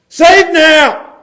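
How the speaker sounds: high, loud cry of a crowd. Acted. Preacher acts crowd calling to Jesus, crying out. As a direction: call it out as a plea to the king.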